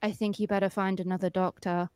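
low, soft, deadpan delivery; imitating a woman's deadpan announcement. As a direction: drop it down and say it dead serious. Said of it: "like, really dead ass".

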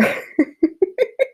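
high, rapid embarrassed giggle. Real, not acted. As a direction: giggle at your own awkwardness. Embarrassed laugh at own words.

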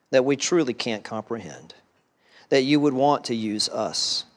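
soft, reverent closing prayer. Acted. Pastor closing the sermon in a soft, reverent prayer to God. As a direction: pray quietly over the congregation.

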